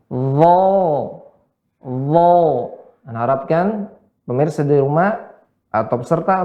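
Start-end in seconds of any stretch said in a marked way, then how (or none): 0.1-2.4 DHA held long and heavy, tongue tip at the upper teeth; tongue-tip thick dha. Shown as a demonstration. Teacher modelling for viewers at home, heavy drawn-out DHA. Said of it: "dan juga ujung lidah".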